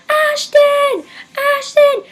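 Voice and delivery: high, loud shouted name; excited fan scream. Retold or imitated. Fan calling to band member leaving the bus, shrieking. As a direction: shriek for your idol's attention.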